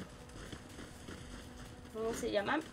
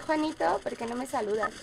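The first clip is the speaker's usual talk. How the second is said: ordinary talk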